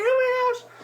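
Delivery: very high, excited squeal; ecstatic squeal. Retold or imitated. Winner celebrating on the phone with friend, ecstatic squeal. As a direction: squeal it in overjoyed disbelief. Retold screaming news to friend.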